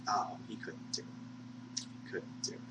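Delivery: hushed, whispery aside; mock disappointment. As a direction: murmur it under your breath, barely voiced. Mock aside after the taunt.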